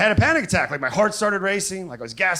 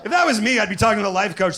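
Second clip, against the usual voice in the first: normal talking voice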